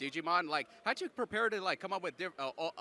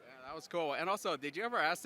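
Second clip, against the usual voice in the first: usual speaking voice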